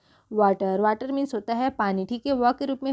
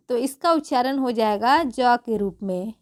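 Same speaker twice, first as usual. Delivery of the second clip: normal talking voice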